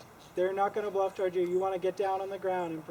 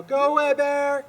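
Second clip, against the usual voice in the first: scaring off a bear; 7 semitones higher, 10 dB louder, slower than usual. Shown as a demonstration. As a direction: yell it loud and high. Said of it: "yell and scream at the bear and go".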